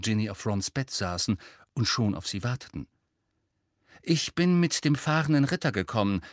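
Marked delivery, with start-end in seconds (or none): 4.0-6.2 buoyant, higher-pitched announcement; upbeat, lilting arrival; beschwingt. A narrator's reading. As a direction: breeze in full of bounce. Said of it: "sagte Hermine beschwingt".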